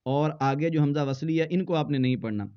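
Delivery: ordinary delivery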